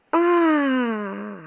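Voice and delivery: high wail, slowly falling away; long wailing scream. A narrator's reading. The Queen dreads the brooch coming undone, long wail. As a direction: stretch out a plaintive "aaah". Queen wails before the accident.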